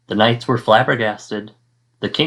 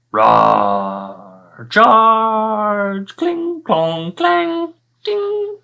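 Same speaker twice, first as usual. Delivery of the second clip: battle noises; 11 semitones higher, 3 dB louder, much slower than usual. Acted. Narrator acts out the king and dragon battling, loud. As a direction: roar then high shouted charge and clangs. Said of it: "a lot of shouting".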